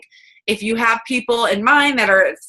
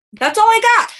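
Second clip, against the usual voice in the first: bright sign-off; 12 semitones higher, 5 dB louder than usual. Real, not acted. Cheerful sign-off.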